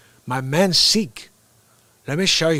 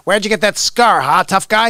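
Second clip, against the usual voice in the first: louder mocking officer; tough cop voice; 5 semitones higher, 7 dB louder than usual. Retelling Scarface bus scene, loud taunting cop. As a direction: sneer at him like a cop. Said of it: "he has that scene where he's like".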